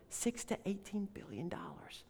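breathy awed whisper. Acted. Preacher whispers the forgiven debt to his congregation. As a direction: drop to a whisper to let the sum sink in.

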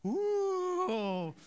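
long high "ooh" whoosh; imitated whoosh. Dog takes off after a squirrel, high whooshing ooh. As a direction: let out a high, drawn-out whoop.

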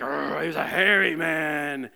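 growly "arr" into a put-on voice; hammed-up hairy man. Shown as a demonstration. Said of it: "You gotta say it like".

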